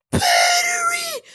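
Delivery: high, slow panicked yell; panicked shout. Real, not acted. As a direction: cry out in alarm. Panic over battery.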